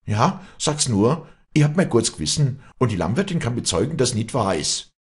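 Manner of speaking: high, loud defiance; defiant retort. Acted. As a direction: answer back in indignation. Acted quarrel, defiant answer.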